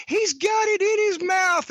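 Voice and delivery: high, slow mock yell from the crowd. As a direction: call it out like a fan in the stands. Crowd screaming in story.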